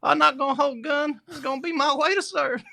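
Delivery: put-on Southern American accent. Podcaster mocking a film hero's line, exaggerated Southern American accent. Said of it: "It's Southern American".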